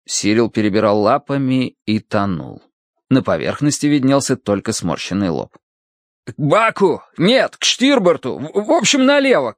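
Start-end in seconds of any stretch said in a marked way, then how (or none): 6.3-9.5 high, faltering shouted directions; flustered shout. A narrator's reading. Narrator directs the rower toward the dog, flustered shout. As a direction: shout and keep correcting yourself. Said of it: "крикнул я".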